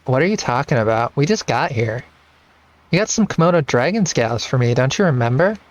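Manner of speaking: put-on Lena's girlish voice, lightly done. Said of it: "I have to do my Lena voice again".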